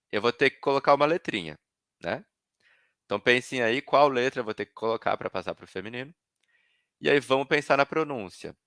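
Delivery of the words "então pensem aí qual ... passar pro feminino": usual speaking voice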